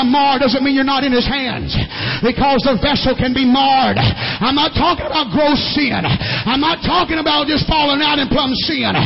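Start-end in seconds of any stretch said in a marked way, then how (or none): 2.3-9.0 loud, rising, slowed sermon climax; preaching at full force. Acted. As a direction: drive it home louder line by line. Building altar call, loud.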